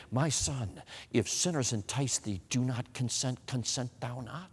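soft, hushed retelling of a mother's warning; tearful warning. Retold or imitated. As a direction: plead quietly, as a mother warning her son. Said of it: "tears filling her eyes and warning me".

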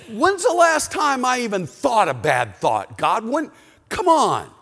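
high, loud mock indignation; exasperated protest. Acted protest of innocence to God.

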